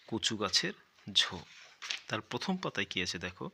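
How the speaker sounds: normal talking voice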